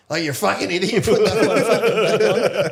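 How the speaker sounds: high, loud mock outburst at a face tattoo; mocking angry stranger. Retelling how strangers yelled about his face tattoo, mock loud outburst. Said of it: "Then when I put it back on".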